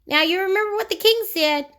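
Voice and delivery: wag a finger as the goblin; warning goblin. Acted. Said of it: "warned the goblin".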